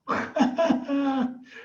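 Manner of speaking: long, high burst of laughter. Real, not acted. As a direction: burst out laughing after the story. Laughs at own awed reaction.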